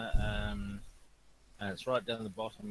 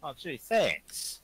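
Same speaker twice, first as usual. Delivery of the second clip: sarcastic; 5 semitones higher, 9 dB louder, much slower than usual. Real, not acted. Teased as creepy, mock thanks.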